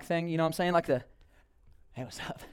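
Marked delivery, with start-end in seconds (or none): 1.9-2.4 low, soft, casual cool-guy hello; too-cool greeting. Pretend. Jogging runner greets Catherine, low and cool. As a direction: play it effortlessly cool.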